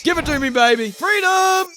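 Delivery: loud, high cry of Freedom; hammed-up patriotic battle cry; triumphant shout. Shouting Freedom to his viewers, triumphant.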